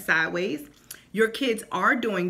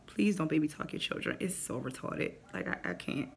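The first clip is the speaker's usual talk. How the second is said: muttered aside; 5 semitones lower, 9 dB quieter than usual. Real, not acted.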